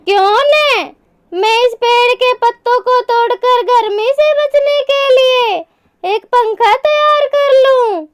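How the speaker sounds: high-pitched, caring storybook mother; concerned mother bird. Acted. (चिंतित माँ चिड़िया की ऊँची आवाज़)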